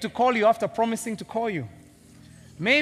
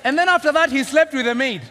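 louder, higher, outraged disbelief; loud incredulous preaching; 6 semitones higher, 9 dB louder than usual. Real, not acted. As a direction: hammer the scandal home. Preaching scandal loudly.

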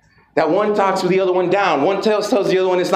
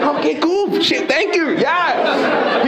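high cheerful thanks; grateful frog; 8 semitones higher, much slower than usual. Retold or imitated. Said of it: "he's like".

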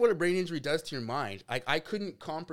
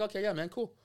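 higher-pitched, breezy retold acceptance; mock easygoing turnaround; suddenly agreeable; 7 semitones higher than usual. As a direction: re-enact instantly dropping the argument. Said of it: "my attitude switched, like, immediately".

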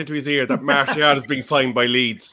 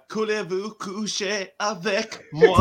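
mock French; 3 semitones higher, slower than usual. Mock French line to Martial.

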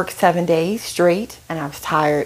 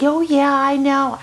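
put-on southern accent; 8 semitones higher, 3 dB louder than usual. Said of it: "in her sweet southern accent".

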